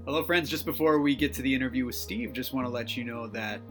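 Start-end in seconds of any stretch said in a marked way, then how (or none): none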